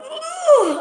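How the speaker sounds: high, loud laughing groan of disgust; hammed-up sick groan; mock gut groan. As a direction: groan like your stomach turned. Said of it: "My gut would have gone".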